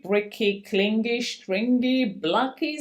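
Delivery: plain reading voice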